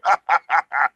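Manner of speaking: staged gloating cackle; wrestler's villain laugh. Shown as a demonstration. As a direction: cap the promo with a theatrical laugh. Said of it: "do the wrestling thing".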